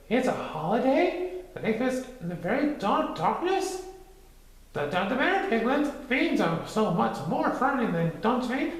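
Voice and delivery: small frightened pig voice; timid high Piglet. A narrator's reading. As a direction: squeak it nervously.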